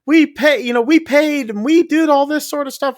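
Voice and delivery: high, panicky retelling of frightened guests; imitating scared tourists, high and urgent; mock panic. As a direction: pitch it way up like they're freaking out. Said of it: "They're all freaking out".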